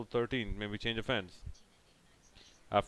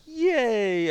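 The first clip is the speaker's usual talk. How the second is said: high mock cheer; sarcastic cheer; 10 semitones higher, 6 dB louder than usual. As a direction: fake excited yay. Sarcastic cheer after over.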